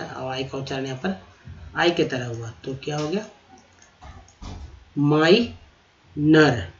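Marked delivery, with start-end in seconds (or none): 5.0-6.7 माइनर stretched out slowly, ending falling; very slow demonstration. Shown as a demonstration. Teacher repeating माइनर slowly after explaining it. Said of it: "O R लगा ना में तो नर हो गया".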